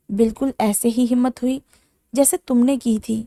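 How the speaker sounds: ordinary delivery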